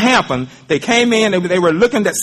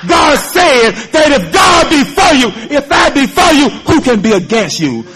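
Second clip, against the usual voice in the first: full-force preaching; 3 semitones higher than usual. Acted. Preacher assuring the congregation of their inheritance, full force. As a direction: proclaim it to the church.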